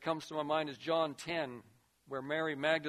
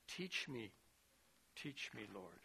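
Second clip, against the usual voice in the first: soft, lower plea; hushed prayer; 7 semitones lower, 14 dB quieter than usual. Real, not acted. Preacher praying to the Lord for teaching, hushed. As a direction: pray it quietly, earnestly. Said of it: "preacher's own prayer, hushed".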